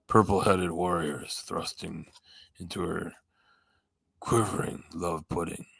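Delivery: slow, low mock-erotica reading; sexy deep voice. Shown as a demonstration. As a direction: savour it slowly, low and sultry. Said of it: "sexy, deep voice".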